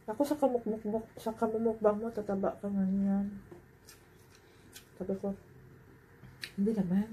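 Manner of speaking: chewing while speaking; muffled words around food; talking with mouth full. Real, not acted. Seen 4: Cheeks bulge, chewing with food in mouth during speech.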